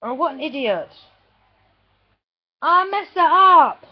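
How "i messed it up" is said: loud, drawn-out self-reproach; exasperated cry. Real, not acted. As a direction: wail it slowly and loudly at your own mistake. Annoyed at own mistake.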